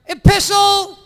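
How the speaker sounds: high, loud sermon word; priest's word. Acted. Priest intoning a funny church word, high and loud.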